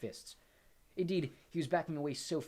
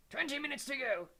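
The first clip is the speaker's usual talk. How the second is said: high-pitched exam invigilator voice; Professor Tofty; 13 semitones higher, 3 dB louder than usual. A narrator's reading. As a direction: announce the time in a high professorial voice. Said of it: "said Professor Tofty".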